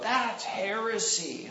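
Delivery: high, slow, drawn-out heckle; a hint of an outraged heckler; mild mock yell. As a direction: lightly mimic a heckler calling out. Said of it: "he yelled out".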